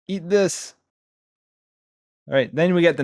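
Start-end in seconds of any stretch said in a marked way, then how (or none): 0.1-0.7 hero voice. Pretend. Voicing the hero's line.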